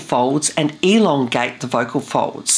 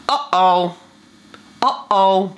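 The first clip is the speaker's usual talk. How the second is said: Uh-oh with a hard catch at the start; a tiny cough into Uh-oh; glottal uh-oh; 3 semitones higher, slower than usual. Shown as a demonstration. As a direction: pop the vowel with a clean glottal stop. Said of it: "So say this after me".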